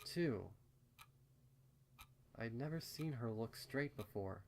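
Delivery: ordinary delivery, nothing marked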